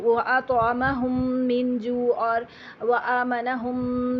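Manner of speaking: مِن with a held nasal ghunnah, slowed; ghunnah demo. Shown as a demonstration. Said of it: "یہاں پر ہم نے غنہ کیا تھا". (مِن میں غنہ)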